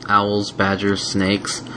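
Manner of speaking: ordinary delivery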